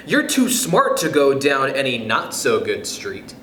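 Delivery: plain reading voice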